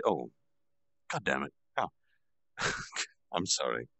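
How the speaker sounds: ordinary delivery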